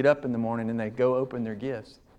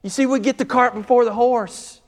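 high, loud, rising preacher's cry; full-force preaching; 12 semitones higher, 6 dB louder than usual. Acted. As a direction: preach it out to the whole room.

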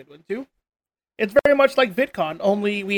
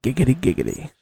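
lower, sly giggity; giggity in the deep voice; 7 semitones lower than usual. Pretend. On request, giggity in the deep after-dark voice. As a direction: drop low and leer it out. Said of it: "Can I get a giggity out of you in that voice?".

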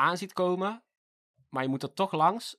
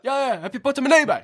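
higher, louder demanding voice; street kid; 9 semitones higher, 9 dB louder than usual. Retold or imitated. A street kid demanding a boy's wallet, high pushy voice.

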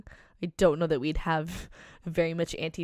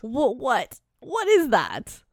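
loud, high-pitched bafflement; utterly baffled outburst; incredulous; 5 semitones higher, 6 dB louder, slower than usual. Real, not acted. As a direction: draw out a loud, high, disbelieving question. Baffled reaction to a silly name.

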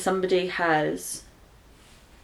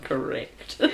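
laughing agreement; 6 semitones lower, 9 dB quieter than usual. Retold or imitated. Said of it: "You laugh and you're like".

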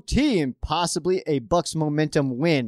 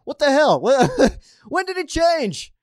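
high, loud incredulous outburst; outraged disbelief, laughing at the end; heated rant breaking into a laugh; worked up; 11 semitones higher, 5 dB louder than usual. Real, not acted. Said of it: "I got a little worked up there".

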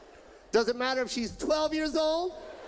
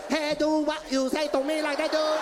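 mock Vietnamese accent, a full stop after every word; hammed-up staccato Vietnamese accent. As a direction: chop each word apart in a Vietnamese accent. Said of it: "put a period after every word".

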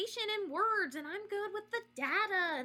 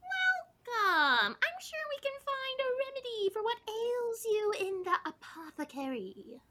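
fantasy innkeeper voice; 5 semitones higher than usual. Pretend. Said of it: "my brain is still logging out of Azeroth".

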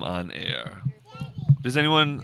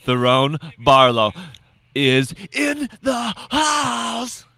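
announcer voice; 7 semitones higher, much slower than usual. Acted. Announcing guest arrival.

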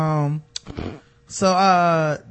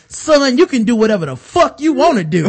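retold Lafayette's defiance; 7 semitones higher, 5 dB louder than usual. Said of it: "He told, he said".

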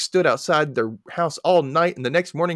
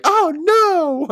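high, loud squeal of alarm; mock alarm; 7 dB louder than usual. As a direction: shriek it like sudden panic. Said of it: "wouldn't you be like".

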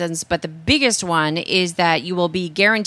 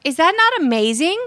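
excited; 9 semitones higher than usual. Real, not acted. Marvelling at the paper airplane.

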